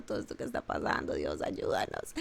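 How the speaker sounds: susurro sin voz, casi llorando, muy bajo; súplica susurrada. Acted. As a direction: implora a Dios en un susurro quebrado. Pueblo llorando ante Dios. (el pueblo ruega a Dios entre lágrimas, susurrando)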